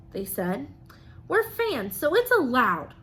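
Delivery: lively, sing-song storybook reading; reading to children. Acted. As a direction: read it like a rhyming picture book to the class. Teacher reads picture book aloud.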